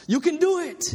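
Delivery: high, loud coach's yell; coach screaming. Retold or imitated. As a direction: yell encouragement across the arena. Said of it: "started screaming out to her".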